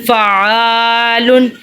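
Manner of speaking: فعالن with a long "aa", short "lun"; lengthened middle vowel. Shown as a demonstration. Teacher modelling the lengthening rule, drawn-out middle vowel. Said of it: "اس کو ہم لمبا کرتے ہیں".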